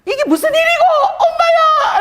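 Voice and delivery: loud, very high-pitched Busan exclamation; Busan dialect amazement. Retold or imitated. Owner amazed at her healed leg, crying out in Busan dialect. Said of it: "부산 사투리로".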